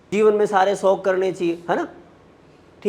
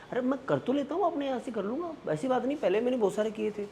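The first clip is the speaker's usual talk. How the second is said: soft, higher-pitched self-excusing voice; making excuses; 6 semitones higher, 10 dB quieter, faster than usual. Acted. Talking oneself out of the morning session, softly making excuses. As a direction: wheedle quietly, as if talking yourself out of it.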